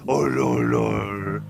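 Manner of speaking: deep low groaned lord; mock exasperation. Keeper sighing at the lion, in a very low mock groan. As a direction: drop way down in comic despair.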